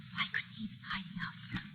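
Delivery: hushed, uneasy whisper; fearful whisper. Acted. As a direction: whisper it, afraid he's close. Afraid someone lurks nearby.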